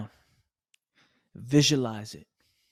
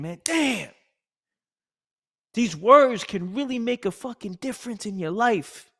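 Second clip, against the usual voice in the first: higher, louder awestruck thought; inner realization; 6 semitones higher, 4 dB louder than usual. Retold or imitated. Speaker voicing his own inner thought, higher and louder. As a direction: let the thought land with wonder. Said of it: "So then I start thinking".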